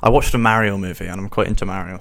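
normal talking voice

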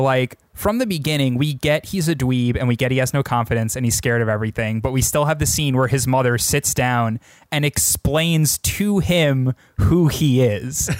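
ordinary talk

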